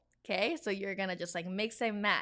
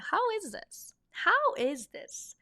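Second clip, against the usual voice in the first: high, questioning shopper voice; asking a friend; 11 semitones higher than usual. Pretend. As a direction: ask your friend if the outfit looks good. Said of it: "and you're like".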